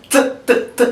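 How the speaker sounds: short unvoiced t with no vowel; soundless t. Shown as a demonstration. Teacher showing the class a soundless t. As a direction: press out t with no sound behind it. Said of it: "you press soundlessly".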